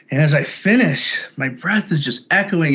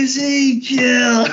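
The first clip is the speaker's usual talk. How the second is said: high, far-off laughing voice calling a name; laughing spirit voice; 12 semitones higher, much slower than usual. Retold or imitated. Retelling a trip, imitating the voice laughing at him on the floor. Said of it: "and it's laughing".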